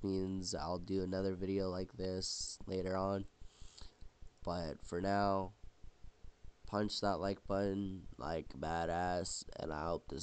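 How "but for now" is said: usual speaking voice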